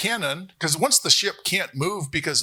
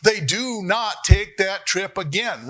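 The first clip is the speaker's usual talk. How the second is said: louder, higher mock declaration; emphatic proclamation; 4 semitones higher, 4 dB louder, slower than usual. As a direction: proclaim it slowly, as if for a whole crowd. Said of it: "speak as if with one voice".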